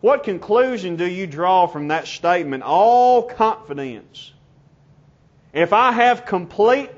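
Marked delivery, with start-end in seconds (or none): 2.6-4.3 very slow, drawn-out "all"; underlined emphasis. Real, not acted. Preacher asking what to conclude from the statement, heavy stress on "all". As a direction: hold "all" long and strong, then fall away. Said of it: "underline it".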